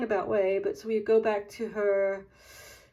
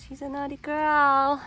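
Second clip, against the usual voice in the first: playful scolding, higher; 5 semitones higher, 7 dB louder than usual. Real, not acted. Scolding the dog again.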